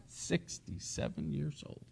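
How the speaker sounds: very soft, slow aside after a chuckle; hushed amused disbelief. Real, not acted. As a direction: murmur it slowly, amused and incredulous. Chuckling aside repeating the age.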